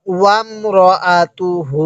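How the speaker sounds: ওয়ামরাআতুহু syllable by syllable, last hu held; measured chant of ওয়ামরাআতুহু with drawn-out ending; slow model recitation. Shown as a demonstration. Teacher asking learners to say along, slow measured ওয়ামরাআতুহু. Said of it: "আরেকবার".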